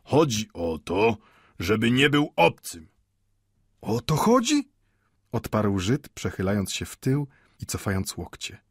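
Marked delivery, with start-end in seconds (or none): 0.0-2.9 pompous pathos. A narrator's reading. Watchmaker explains his principle to old Mendel, pompous. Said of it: "rzekł patetycznie zegarmistrz". 3.8-4.7 high-pitched, incredulous question; questioning echo. A narrator's reading. Mendel echoes the watchmaker, questioning.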